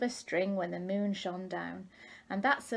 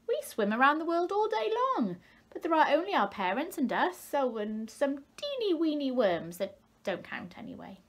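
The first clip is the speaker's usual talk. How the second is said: boastful tadpoles; 6 semitones higher than usual. A narrator's reading.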